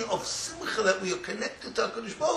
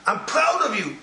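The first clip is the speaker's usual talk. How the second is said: mock shout; 6 semitones higher, 4 dB louder than usual. Shown as a demonstration. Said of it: "That's what you shout to them".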